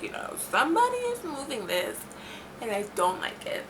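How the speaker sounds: hushed, uneasy retold protest; quiet unease. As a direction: say it softly, creeped out. Retelling her uneasy protest to friends.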